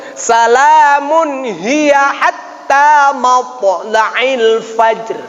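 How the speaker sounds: slow melodic reading of the whole verse; model recitation. Shown as a demonstration. Teacher recites the full verse for students, slow chant. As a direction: chant it slowly and cleanly.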